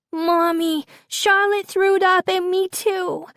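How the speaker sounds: put-on very high, outraged small child; outraged little Rose. A narrator's reading. Daughter reports the throwing up to mom, outraged child's voice. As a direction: tattle in a squealing toddler voice.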